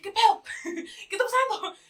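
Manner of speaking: high, loud shocked cry with a laugh; retold disbelief. Reviewer retelling her reaction to the audiobook, high shocked cry. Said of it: "Y yo dije".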